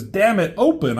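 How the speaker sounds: acted burst of exasperation; frustrated outburst. Retelling desperate hero's outburst.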